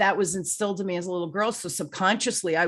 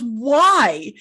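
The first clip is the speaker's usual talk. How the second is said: louder why; 4 semitones higher, 8 dB louder than usual. Retold or imitated. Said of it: "She goes".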